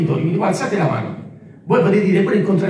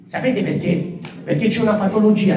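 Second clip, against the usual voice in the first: normal talking voice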